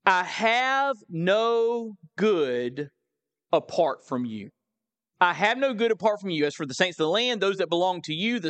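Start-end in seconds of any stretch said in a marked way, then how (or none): 0.0-4.5 slow, weighty reading. Real, not acted. This is a powerful statement. 5.2-6.5 quick run through the verse; brisk rereading. Real, not acted.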